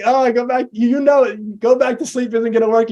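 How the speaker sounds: high, weary exasperation; fed-up half-asleep parent; exasperated parent. Retold or imitated.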